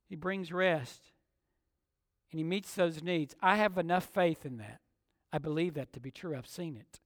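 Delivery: soft, low, sincere assurance; quiet personal conviction; hushed testimony. Real, not acted. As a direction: drop right down, as if confiding a belief. Preacher drops to personal testimony.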